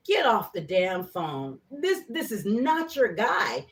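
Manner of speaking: slow, deliberate inner warning; reality check. Retold or imitated. Telling herself to drop the caller, slow firm warning. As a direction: spell it out slowly, firmly. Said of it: "this reality check of like".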